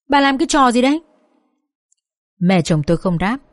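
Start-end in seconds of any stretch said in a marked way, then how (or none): 0.1-1.0 raised-pitch, understated outburst; mild mock yell. A narrator's reading. Father-in-law confronts his wife over the slap, understated yell. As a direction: demand it indignantly, without a full shout. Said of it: "Bố chồng tôi thì gào lên".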